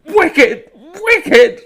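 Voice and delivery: loud, high, half-laughing cry; tormenting himself, shrieking and laughing; hysterical shout. Acted. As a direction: break into manic self-loathing. Hysterical self-berating.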